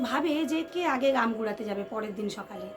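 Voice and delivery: ordinary delivery, nothing marked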